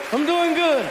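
high, giddy reply; spazzing out. Retold or imitated. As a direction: answer like an overexcited fan. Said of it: "And I'm spazzing out".

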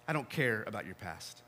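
hushed and gentle, almost whispered; soft tender reassurance. Acted. Jesus speaking gently to Matthew, soft and reassuring.

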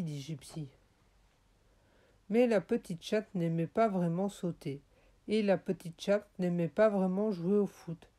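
ordinary delivery, nothing marked

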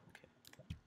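hushed, barely voiced self-urging; whispered urging. Real, not acted. Player urging himself on mid-game, whispered. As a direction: whisper it to yourself.

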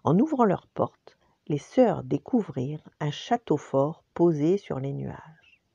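plain reading voice

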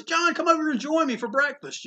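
a friendly call across the breakfast room; imitating Eisner. Spotting John at the Omni, Eisner calls him over in a lifted voice.

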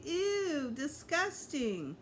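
high squealed ew, then slow drawn-out disgusting; grossed out, stretching the word; disgusted. A narrator's reading. Girl reacting to something gross, disgusted.

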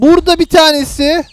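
normal talking voice

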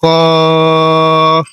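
কফ held about four seconds; drawn-out Arabic letter. Shown as a demonstration. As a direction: stretch কফ long and steady. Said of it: "এটাও চার second টান দিতে হবে". (কফ চার second টেনে)

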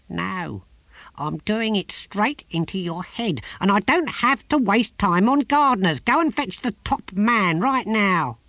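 bossy little god; the tortoise god ordering the gardener; impatient tortoise god. A narrator's reading.

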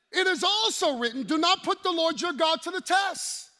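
loud, high commanding quote; preaching at full force. Acted. Preacher thundering Jesus' answer. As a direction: declare it over the crowd.

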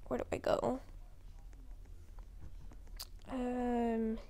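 usual speaking voice

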